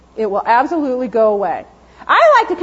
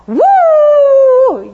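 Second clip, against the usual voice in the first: high, loud whooping bark; excited dog woo; 12 semitones higher, 8 dB louder than usual. Retold or imitated. Dog charging the kennel run, loud high whoop.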